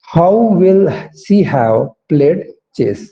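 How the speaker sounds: very slow, every word spaced out; slow corrected version. Shown as a demonstration. Teacher redoing the question slowly after a mistake. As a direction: say the right form carefully. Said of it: "फिर से बोलूंगा".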